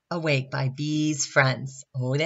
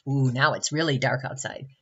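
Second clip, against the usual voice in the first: ordinary delivery, nothing marked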